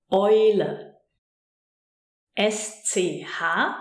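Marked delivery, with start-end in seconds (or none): 0.1-0.9 Eule starting with an "oy" sound. Shown as a demonstration. Teacher showing the combination E U, Eule said with oi. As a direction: say Eule clearly, EU pronounced like Oi. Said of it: "which is pronounced Oi".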